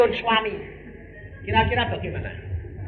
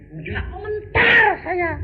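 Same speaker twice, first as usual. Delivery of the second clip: mock shout; slower than usual. Retold refusal, shout heard.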